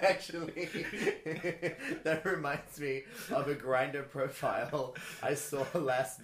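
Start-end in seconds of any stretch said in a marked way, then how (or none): 0.0-2.0 laughing. Real, not acted. Laughing as she starts.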